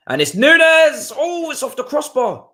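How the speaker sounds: sudden high shout at a near miss; excited shout. Real, not acted. Fan watching the match, shouting in excitement. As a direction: yell it as the ball hits the bar.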